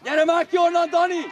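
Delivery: high, very loud call; impatient shout. Real, not acted. Spectator calling Dani out of there, shouting. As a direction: yell for the player to move.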